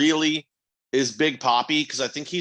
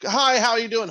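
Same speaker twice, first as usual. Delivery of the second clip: higher, louder bright greeting; mock casual hugger; 9 semitones higher, 5 dB louder than usual. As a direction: greet a stranger like an overeager hugger.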